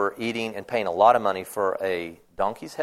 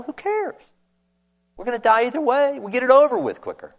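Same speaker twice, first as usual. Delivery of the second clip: much higher, carefree, reckless pitch; excited leper; 10 semitones higher than usual. Retold or imitated. Desperate leper telling the others who cares, much higher and excited. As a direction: throw up your hands, nothing left to lose. Lepers deciding to enter camp.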